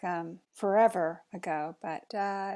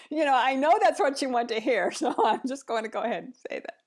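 quoting daughter laughing; 4 semitones higher, 5 dB louder, faster than usual. Retold or imitated. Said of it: "she said to me".

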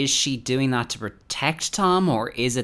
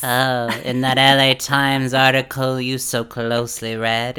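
slow, louder snide mock drawl; slow, louder sarcastic impression of Erika; mock Erika sarcasm; 3 semitones higher, 7 dB louder, slower than usual. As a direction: sneer it out, drawn out and louder. Mocking Erika's sarcastic remark.

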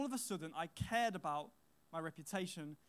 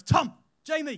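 sharp shouted names, much higher and louder; teacher shouting; 9 semitones higher, 17 dB louder than usual. Retold or imitated. Teacher catching boys fighting, shouting their names. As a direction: yell the boys' names across the courtyard. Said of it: "the teacher comes round and goes".

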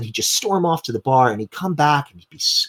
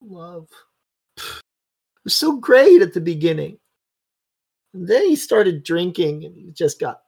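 high, rising to loud tearful outburst; distraught wife; 8 semitones higher, 4 dB louder than usual. Acted. Wife unburdening herself to a stranger, tearful and high. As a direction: let her grief spill over, then demand who you are. GM voices crying drunk's wife.

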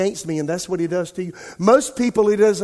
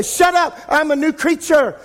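sharp, high, loud retort to the doubting voice; defiant shout; 8 semitones higher, 3 dB louder than usual. Retold or imitated. Preacher shouting down his inner accuser, loud and defiant. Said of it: "I say".

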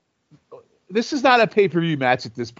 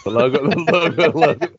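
giggling through a fake British accent; cracking up while repeating; laughing mock British; 5 dB louder than usual. Mocking logo asshole, laughing.

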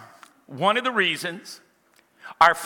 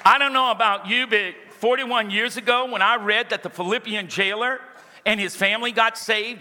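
raised, driving pulpit voice building to shouts; full-force preaching; 5 semitones higher than usual. Acted. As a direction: preach it hard to the congregation.

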